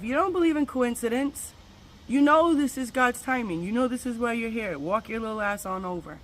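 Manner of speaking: high, loud push that eases off by the last line; urgent self pep talk. Retold or imitated. Urging herself toward the crying girls, high and loud. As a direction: talk yourself into going over. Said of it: "I'm saying to myself".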